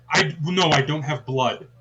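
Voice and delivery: normal talking voice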